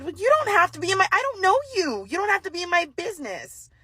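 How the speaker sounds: high and fast, fed up; exasperated. Retold or imitated. Telling the nosy woman off, high and exasperated. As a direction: snap back in disbelief at the nosy shopper. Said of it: "I said".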